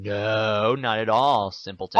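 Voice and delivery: loud, mocking put-down; mock haughty dismissal; condescending retort. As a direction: sneer it at him like a snob. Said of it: "like, saying".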